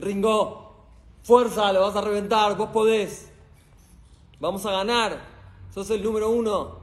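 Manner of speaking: loud, high pep-talk yells to a boxer; corner coach shouting. Retold or imitated. Coach in the corner pumping up Ringo, loud rousing shouts. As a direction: fire up your fighter between rounds. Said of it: "te dicen".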